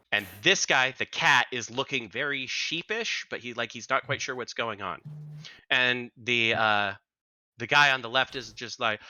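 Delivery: ordinary talk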